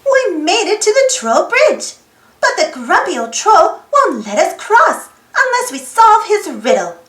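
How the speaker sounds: ordinary delivery, nothing marked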